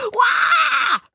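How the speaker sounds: very high frustrated "waah" scream; drawn-out high yell; loud scream. A narrator's reading. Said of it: "laut schreien".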